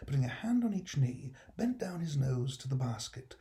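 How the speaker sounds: ordinary delivery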